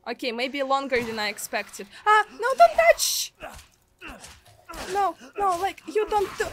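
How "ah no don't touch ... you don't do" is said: high loud cry of no, don't touch; panicked yell. Real, not acted. Streamer fending off a game character, panicked yell. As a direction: yelp it out as something grabs you.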